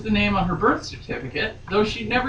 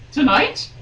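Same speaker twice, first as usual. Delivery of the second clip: squeaked; 6 semitones higher than usual. Acted. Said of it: "she squeaked".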